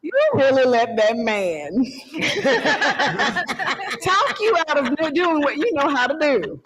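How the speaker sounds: high, loud, laughing scold at herself; incredulous laughing rebuke; laughing disbelief. Retold or imitated. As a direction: laugh at her own mistake. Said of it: "And I'm just like".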